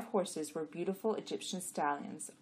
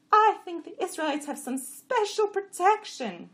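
high, loud donkey voice; complaining jenny; 14 semitones higher, 10 dB louder than usual. A narrator's reading. Jenny grumbling to the jacks, high and loud. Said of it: "said Chamra".

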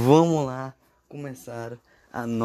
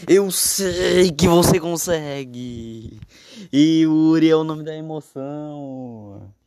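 higher, louder, slow hype call; explosive goal commentary; 6 semitones higher, 6 dB louder, slower than usual. Acted. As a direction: cheer him on like a TV narrator. (narração empolgada de torcida)

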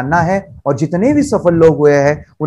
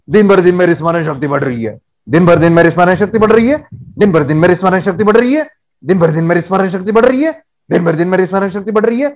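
rattled off fast without any feeling; mechanical rote recital; faster than usual. Shown as a demonstration. Coach showing kids the wrong way, fast and emotionless. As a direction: parrot it quickly like a bored chant. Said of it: "ऐसे नहीं बोलना है बच्चों".

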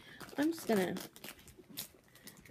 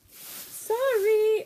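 much higher and louder sing-song sorry; 12 semitones higher, 5 dB louder than usual. Real, not acted. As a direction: sing it out high and playful. Playful apology, repeated.

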